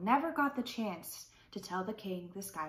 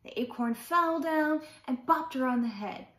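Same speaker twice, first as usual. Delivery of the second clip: ordinary delivery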